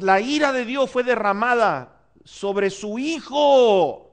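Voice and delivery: high, slow, fervent sermon delivery; slow, high-pitched pulpit preaching building to a cry; impassioned preaching. Acted. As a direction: preach it out with rising fervor, stretching each phrase. Preaching climax on the cross.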